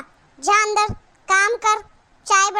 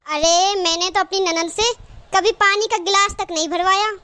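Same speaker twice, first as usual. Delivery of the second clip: ordinary delivery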